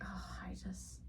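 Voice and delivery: overcome, sighing whisper; swooning whisper. Real, not acted. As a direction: let it out as a smitten, hushed sigh. Swooning over favourite scene.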